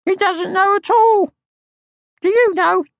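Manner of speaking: tiny cheeky creature voice; little Mai. Acted. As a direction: squeak it like a small bossy girl. Little Mai's voice.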